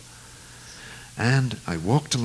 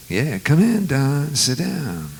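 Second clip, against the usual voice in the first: [name] imitation; 6 semitones higher, slower than usual. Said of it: "And Bobby said".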